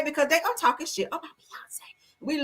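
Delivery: conspiratorial whisper. Real, not acted. Whispering gossip to her viewers.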